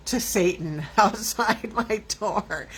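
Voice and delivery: slow, broken up by laughter; real giggle breaking into the words; laughing through words. Real, not acted. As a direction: laugh at how absurd it sounds. Laughs at her own lurid claim.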